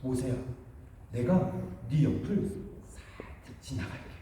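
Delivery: soft, half-whispered promise; God softly speaking to Moses; hushed divine voice. Retold or imitated. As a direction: murmur it gently, as God passing by. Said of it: "하나님께서도 모세에게 뭐라고 했냐면은".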